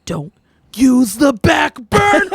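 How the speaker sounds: high, slow, loud mock nagging; hammed-up husband warning about the burner; exaggerated imitation of a fussy husband; mimicking husband. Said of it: "Josh will be like".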